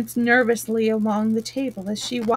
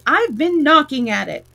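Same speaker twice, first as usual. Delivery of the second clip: impatient; 4 semitones higher, 6 dB louder than usual. A narrator's reading. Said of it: "Alice said impatiently".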